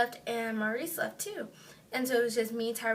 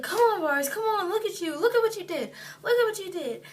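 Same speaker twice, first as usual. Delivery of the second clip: high, louder retold scolding; mad; 7 semitones higher, 5 dB louder than usual. Tyrell to drunk friend who caused the injury, mad scolding. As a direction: act out an angry friend's exasperated rebuke. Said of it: "Tyrell was mad at him".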